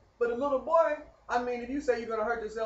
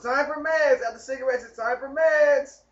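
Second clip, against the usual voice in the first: nurse calling out; 6 semitones higher, 4 dB louder than usual. Retold or imitated. Staff calling meds time.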